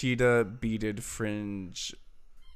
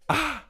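high sung ah; 7 dB louder than usual. Retold or imitated. Imitating favorite moment.

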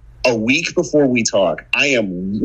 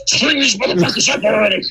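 imitating Dale; 9 semitones higher than usual. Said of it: "Dale's over here like".

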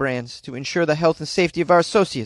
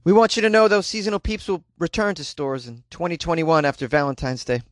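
ordinary talk, nothing marked

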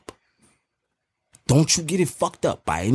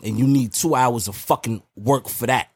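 ordinary talk

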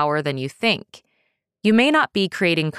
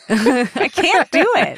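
helpless laughing protest, higher pitched; laughing, giving up; 6 semitones higher, 4 dB louder, slower than usual. Real, not acted. Host failing at the accent for her co-host, laughing protest. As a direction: give up through laughter, drawn out.

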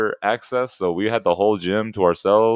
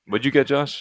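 ordinary talk

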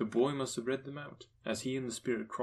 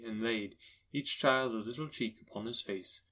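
ordinary delivery, nothing marked

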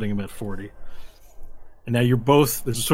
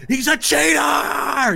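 high, loud yell, last word stretched; long shrieked "cheater"; yelled accusation; 14 semitones higher, 5 dB louder, much slower than usual. Acted. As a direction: denounce him to the whole crowd. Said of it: "he yells out to the crowd".

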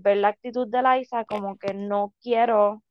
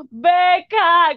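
high, loud mock wail of the name; hammed-up crying call for Becca; 6 semitones higher, 7 dB louder than usual. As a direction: wail Becca like the heartbroken bride. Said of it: "cuando grita Becca".

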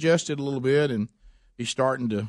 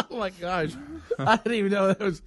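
laughing; 6 semitones higher than usual. Real, not acted. Laughing after joke.

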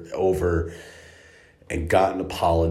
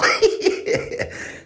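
real burst of squeaky laughter; high squealing laugh. Real, not acted. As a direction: let out a wheezy giggle. Laughing at his own story.

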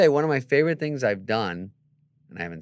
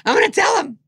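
very high, loud acted cackle into words; hammed-up delighted tattler; gleeful outburst; 13 dB louder than usual. Manager thrilled to pass on a jab, acted gleeful outburst.

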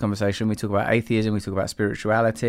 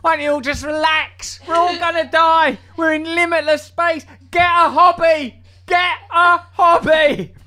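much higher and louder, hammed-up despairing voice; mock exasperated rant; 6 dB louder than usual. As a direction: wail it like a fed-up prophet.

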